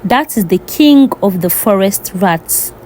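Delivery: ordinary delivery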